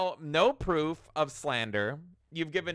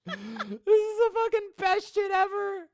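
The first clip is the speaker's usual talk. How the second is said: much higher voice, crying with laughter; laughing so hard the voice breaks; laughing disbelief; 3 dB louder than usual. Real, not acted. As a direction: squeal through the laughter. Laughing at the document.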